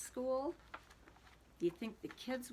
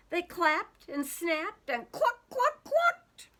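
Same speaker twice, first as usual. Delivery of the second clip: squawking high, loud chicken clucks; clucking chickens; 10 semitones higher, 8 dB louder, slower than usual. Acted. Reading to kids, clucking like wild chickens. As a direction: squawk it like a flapping hen.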